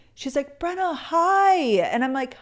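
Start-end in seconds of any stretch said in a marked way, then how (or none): 0.6-1.5 excited hi. Retold or imitated. Fan greeting her on the street.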